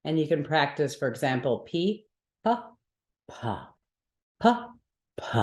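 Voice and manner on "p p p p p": P with a puff of hot air; aspirated P. Shown as a demonstration. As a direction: burst of air on each P. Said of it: "P H version should make a little explosion".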